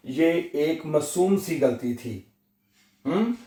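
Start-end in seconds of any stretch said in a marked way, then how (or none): none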